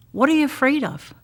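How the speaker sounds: a touch of impatience at the frightened; a little annoyed. Retold or imitated. Jesus to panicking disciples, mildly annoyed. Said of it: "he's a little annoyed".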